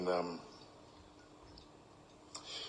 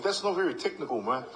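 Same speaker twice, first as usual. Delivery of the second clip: higher teasing retort; mock retort; 7 semitones higher, 4 dB louder than usual. Player answering coach's advice, higher teasing retort. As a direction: tease the coach back. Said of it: "And I think".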